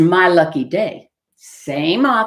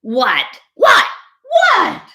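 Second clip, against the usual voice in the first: exasperated shouts climbing to a high screech; shouting fox; 13 semitones higher, 5 dB louder, much slower than usual. Acted. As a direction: repeat it louder and higher each time. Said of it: "shouted the fox".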